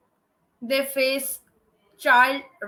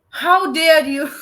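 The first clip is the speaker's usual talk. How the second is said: a mock dramatic how dare you, louder, laughing through it; laughing echo of the child's outburst, hammed up a little; imitating the child's outcry; 7 dB louder than usual. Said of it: "The way he said".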